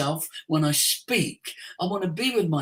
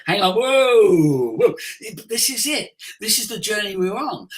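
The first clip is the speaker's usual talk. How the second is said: sudden loud self-check; 6 dB louder than usual. Retold or imitated. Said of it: "you'll remind yourself".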